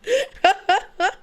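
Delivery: breaks into a high laugh; cracking up; high cackling laugh. Real, not acted. Laughing at own story.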